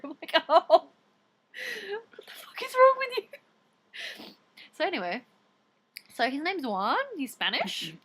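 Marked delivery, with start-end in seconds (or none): 0.5-4.2 high, slow drawn-out wail; hammed-up exasperated squeal; mock horrified disbelief. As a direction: stretch out an appalled, whiny retort. Retold reaction to stalker waiting.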